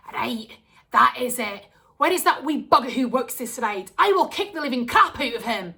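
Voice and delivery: mum ready to march over and fight; loud furious mother's threat; angry mum. Acted. Mum threatens the ride worker.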